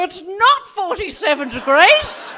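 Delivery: slow, high, loud protesting granny; hammed-up caller correcting the radio; indignant old lady. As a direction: object like an outraged elderly listener. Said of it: "She's going".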